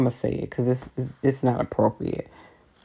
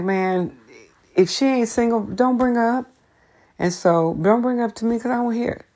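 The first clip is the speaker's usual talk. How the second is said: mock exasperated; 8 semitones higher than usual. Retelling her reply to coworker.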